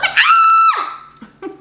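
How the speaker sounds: very high, loud laughing scream; laughing shriek. Retold or imitated. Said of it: "I'm like".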